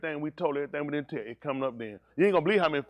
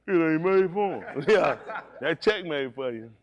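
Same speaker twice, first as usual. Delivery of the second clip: laughing; 5 semitones higher, 6 dB louder than usual. Real, not acted. Joking about the check.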